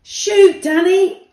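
loud, high urgent call; shouting. A narrator's reading. Asher yells to Danny as she falls, loud urgent shout. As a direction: yell it as you fall. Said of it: "Asher shouted as she fell".

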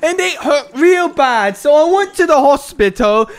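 goofy author voice. Acted. Mocking the friend's long guide reading, goofy author voice. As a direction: ramble pompously in a high goofy voice. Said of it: "It's just a goofy voice".

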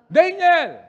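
loud, high anguished cry of a name; anguished shout. Shown as a demonstration. Preacher shouting the king's call on request. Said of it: "Sigaw ka nga, [name]".

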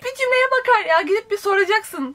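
high-pitched real exasperation; exasperated. Real, not acted. Reader fed up with a character who won't just ask, exasperated. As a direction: let the irritation push your pitch way up. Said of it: "beni çok sinirlendiriyor".

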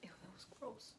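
whispered ew of disgust. Real, not acted. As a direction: breathe it out, grossed out. Disgusted after tasting chips.

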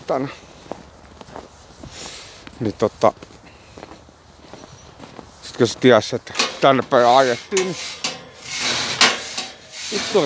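talking around cigarette; cigarette in mouth. Real, not acted. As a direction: lips clamped on cigarette. Seen 1: Lit cigarette held between lips throughout, also before and after.

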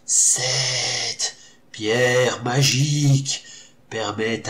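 sepulchral spectre, drawn out slowly. A narrator's reading. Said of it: "d'une voix sépulcrale".

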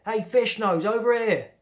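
high, loud call across a playground. A narrator's reading. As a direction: shout over to a classmate. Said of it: "called Robbie, a boy".

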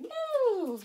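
high, loud "woo" sweep; sliding high-pitched rewind sound effect; rewind whoosh. Shown as a demonstration. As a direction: make a swooping rewind noise. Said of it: "Let's rewind".

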